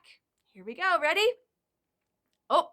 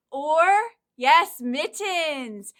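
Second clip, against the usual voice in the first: excited praise; 4 semitones higher, 9 dB louder, much slower than usual. Acted. Praising a child's answer.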